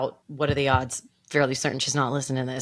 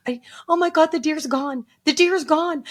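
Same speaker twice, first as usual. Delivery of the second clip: high-pitched hysterical wail, building louder; losing it completely; 7 dB louder than usual. Retold or imitated. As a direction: re-enact a meltdown, wailing higher and louder. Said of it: "then I lost my shit completely".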